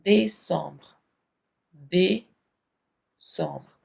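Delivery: décembre with a "day-sombr" sound, not दिसंबर; French décembre. Shown as a demonstration. Teacher contrasts décembre with Hindi दिसंबर. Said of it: "दिसंबर मत बोलिएगा French में".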